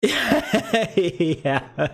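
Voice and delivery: giggling through a yeah; laughing. Real, not acted. Laughing at chat.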